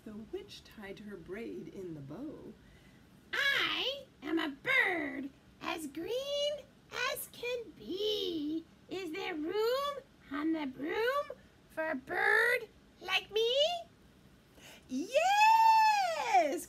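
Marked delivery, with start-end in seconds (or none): 3.3-13.5 bird character. Acted. Bird asking the witch for a ride, birdlike. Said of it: "then said". 14.9-16.7 loud, high, stretched-out yes; shrill it out long, like a witch; witch's cry. Acted. Witch welcoming the dog aboard, crying out. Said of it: "cried the witch".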